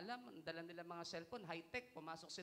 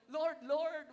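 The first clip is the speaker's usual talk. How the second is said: loud, high mock cry to the Lord; mock calling out; 10 semitones higher, 12 dB louder than usual. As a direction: call out in exaggerated appeal. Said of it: "Sasabihin mo". (pabirong malakas na pagtawag)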